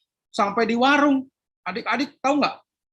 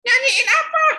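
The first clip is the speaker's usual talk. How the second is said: put-on high childish whine; a grumpy kid answering his mum; sulky boy; 5 dB louder than usual. Philip grumbling back at his mother, high whiny child voice.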